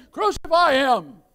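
loud, high, drawn-out mob cry; acted crowd shout. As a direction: shout it slowly as the angry crowd. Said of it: "they shouted to Pontius Pilate".

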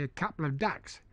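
"ducks" with a Cockney open vowel, like "dacks"; Cockney accent. Shown as a demonstration. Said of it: "if you were asked a Cockney".

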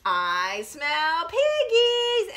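slow, drawn-out, rising wolf call; big bad wolf. Big bad wolf sniffing out the pigs, slow and high. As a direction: savour the scent, stretching each word high. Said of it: "And he says".

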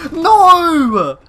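high, loud, drawn-out cry of disbelief; incredulous outcry. Real, not acted. Gamer reacting to a shock in the match, incredulous outcry. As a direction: cry out in disbelief, stretching the words. Exclaiming at events in game.

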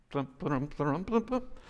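rhythmic galloping noises, climbing high; playful clip-clop that rises in pitch; imitated horse gallop. Shown as a demonstration. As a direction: make hoofbeat sounds with your voice. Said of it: "that's the way you do it".

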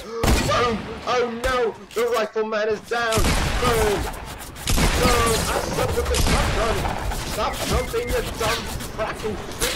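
high, hammed-up battle shouting; frantic yelling. Gamer losing a shotgun fight, frantic high yelling. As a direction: cry out in high-pitched mock outrage.

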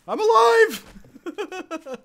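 drawn-out victory cry. Real, not acted. Streamer to his chat, cheering that he survived, a stretched victory cry. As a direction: hold every sound of alive like a triumphant song.

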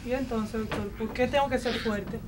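abruptly soft and puzzled; sudden calm. Acted. Wife to doctor, suddenly soft and puzzled. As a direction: drop the wailing, ask quietly.

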